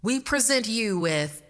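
loud, slow mic announcement; creepy showman on stage; sinister announcer. Acted. Masked host announces on mic.

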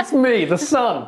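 giggling hammed-up proclamation; laughing mock announcement. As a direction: announce yourself grandly while cracking up. Playful bit about being the sun.

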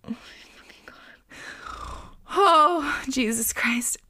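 horrified whisper. Real, not acted. Reacting in horror to story.

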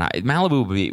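normal talking voice

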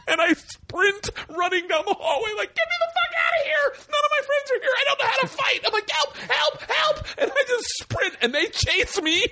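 very high screechy comic panic, laughing; hammed-up cries for help while fleeing; mock panic. Fleeing three guys down the hallway, high mock-panicked screaming.

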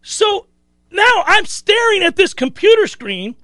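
ordinary talk

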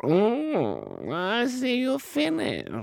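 high, drawn-out cat voice; a pleased cat noticing the empty plate, high and drawn out. Acted. Cat returns to parrot.

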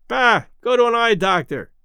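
roaring. Acted. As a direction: roar it back at the umpire. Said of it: "roared Red".

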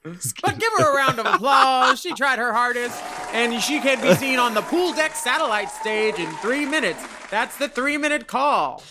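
high, loud announcer over a crowd; cruise announcer. Pretend. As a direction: announce it like a cruise ship host.

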